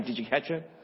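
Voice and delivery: ordinary delivery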